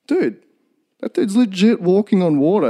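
high-pitched awestruck dude voice; acted-out astonishment; mock amazement. Disciples amazed at walking on water.

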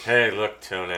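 imitated offhand remark; casual. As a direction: toss it away casually, dropping at the end. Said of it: "I like the casualness".

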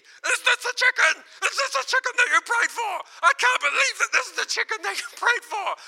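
very high, loud mock shouting; imitated yelling. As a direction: act out his yelling in utter disbelief. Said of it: "He was yelling at me".

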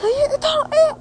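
very high-pitched cry for help; shrieky re-enacted call for rescue; retold scream for help. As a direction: imitate your own panicked yelling. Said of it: "sambil saya teriak kan".

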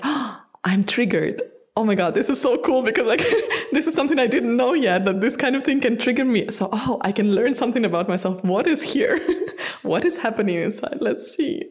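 high, fast and laughing; excited. Retold or imitated. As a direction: gush with giddy curiosity. Imitates own delighted inner voice.

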